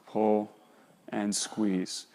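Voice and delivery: "Pull" low and soft, "and squeeze" fading almost to a murmur; a quiet, easy cue without any punch. Shown as a demonstration. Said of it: "notice how I'm squeezing together".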